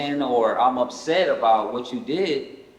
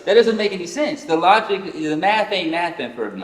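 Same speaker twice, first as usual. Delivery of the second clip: higher and louder, disbelieving; incredulous protest; 6 semitones higher, 4 dB louder than usual. Retold or imitated. Son telling his father the logic fails, loud and incredulous. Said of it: "I'm like".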